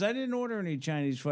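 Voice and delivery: high, baffled denial; retold protest. Called man denies the order.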